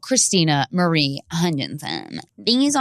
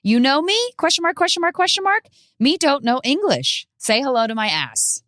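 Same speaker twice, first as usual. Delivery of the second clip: put-on high, childlike reading of the caption; 10 semitones higher than usual. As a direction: read it like a ditzy pop star. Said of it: "the caption reads".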